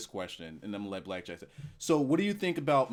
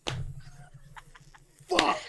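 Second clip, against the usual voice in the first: high, stunned What the fuck; mock outrage. Real, not acted. Host called mean by his guest, squealing in mock outrage. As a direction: act scandalised at the accusation.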